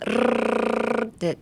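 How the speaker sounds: long rolled R trill; tongue roll. Shown as a demonstration. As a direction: loose tongue fluttering on a sustained R. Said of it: "グルルルってタングロールをして".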